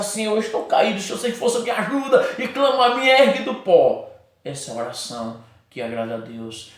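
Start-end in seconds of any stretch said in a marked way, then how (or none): 0.0-4.1 pleading prayer. Retold or imitated. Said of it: "não tem mais força".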